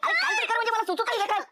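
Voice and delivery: scramble, he has no idea what to do; panicking. Acted. Flustered husband panics over her burnt hand, high frantic voice.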